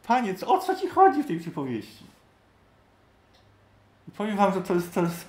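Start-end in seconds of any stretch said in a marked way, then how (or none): 0.1-2.1 lightly voiced bewildered disciples; helpless disciples. Disciples asking Jesus, lightly voiced helpless plea. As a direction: plead like lost followers. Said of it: "w swojej bezradności".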